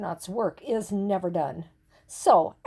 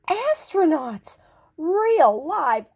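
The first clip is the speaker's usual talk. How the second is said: higher whisper full of wonder; awed whisper; 7 semitones higher, 5 dB louder, slower than usual. A narrator's reading. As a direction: breathe it out in awe.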